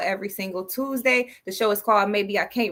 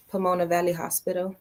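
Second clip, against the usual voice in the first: usual speaking voice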